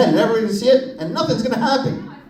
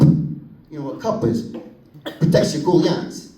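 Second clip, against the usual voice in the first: ordinary talk, nothing marked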